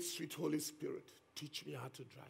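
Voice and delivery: hushed, humble prayer to the Spirit; soft prayerful plea. Retold or imitated. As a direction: pray it softly, like a nervous boy. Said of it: "Can you hear that faith?".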